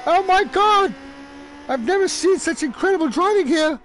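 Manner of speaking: very high-pitched English commentator voice; Murray Walker impression. Failed tribute to Murray Walker, high-pitched exclaiming. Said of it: "that high-pitched voice like that".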